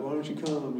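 soft mock-mopey whine. Pastor imitating a mopey church asking after absentees, soft and sulky. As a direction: sulk it quietly, imitating a moper. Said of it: "being all mopey".

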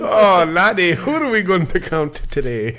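a hammy Irish lad; mock Irish. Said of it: "it's always Irish".

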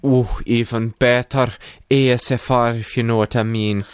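put-on Scottish brogue, relishing it; Scrooge. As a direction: stay in a hammed-up Scottish accent. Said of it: "Scrooge is psyching himself up".

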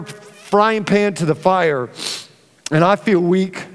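ordinary talk

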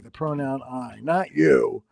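ordinary delivery

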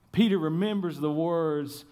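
ordinary talk

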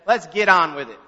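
loud, defiant challenge. A narrator's reading. Defiant boy offering his hands for the belt, challenging. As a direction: throw it out loudly, daring him.

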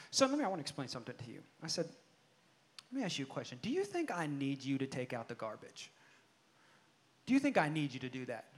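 low, soft, measured dad voice; retold calm, hushed talking-to; quiet fatherly questioning. Father calmly questioning grumbling son.

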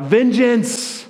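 high, forceful outcry; crying out. Acted. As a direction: cry it out like blood demanding payment. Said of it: "Abel's blood cries out".

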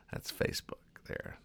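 breathy unvoiced whisper of the punchline. Shown as a demonstration. Host whispering the grim joke to the audience, hushed. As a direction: hush it like a secret aside.

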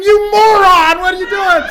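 high, loud exasperated yell; the body screaming at its owner; his body yelling at him mid-sprint. Retold or imitated. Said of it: "my body screaming".

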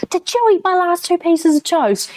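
high-pitched indignant accusation, re-enacted; hammed-up outraged whine; childish ranting. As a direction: act out a little kid's tantrum. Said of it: "I went on this big rant".

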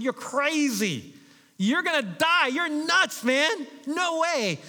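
high, loud mocking crowd calls; jeering crowd. Crowd at Niagara jeering the tightrope walker, loud and high. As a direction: jeer at him like a doubting crowd.

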